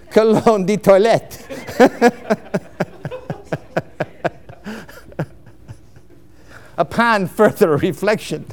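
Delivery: cologne de toilette in mock French, laughing through it; amused mock French accent. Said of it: "You have to say it with a French accent".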